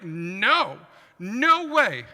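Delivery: loud high outraged refusal; Peter protesting. Acted. Peter arguing with Jesus.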